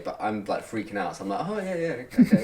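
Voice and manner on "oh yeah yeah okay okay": high, rushed nervous okays; mock panic, pitched up; flustered panicky okay. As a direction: gabble agreement to escape. Panicked agreement acted.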